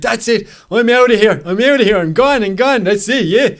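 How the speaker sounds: loud, high-pitched mock Dublin rant; terrible Dublin accent at a shout, breaking into a laugh at the end; bad Dublin accent. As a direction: do an exasperated Dubliner storming off, hammed-up. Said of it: "That's a terrible Dublin accent".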